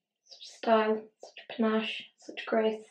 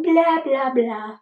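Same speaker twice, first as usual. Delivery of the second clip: sudden higher, louder dismissive blah; mock blah blah; 3 semitones higher, 6 dB louder, much slower than usual. Tired vlogger mocking herself to viewers, dismissive blah. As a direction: toss it off as nonsense, a burst up high. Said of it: "I'm like".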